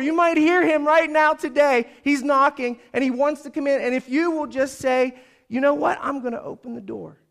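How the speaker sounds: high, loud, urgent sermon voice; full-force preacher easing at the end; impassioned preaching. Acted. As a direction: urge them to respond right now.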